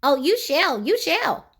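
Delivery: high, sly goblin promise; a lightly voiced cheeky goblin; grinning goblin. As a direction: grin while you say it twice. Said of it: "grinned the goblin".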